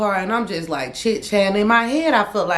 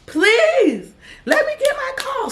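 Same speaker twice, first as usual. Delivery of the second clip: very high, pleading whine; desperate begging; slower than usual. Retold or imitated. Locked in the cell, she begs the guards for her call, very high. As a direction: beg like a caged inmate. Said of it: "I'm in there".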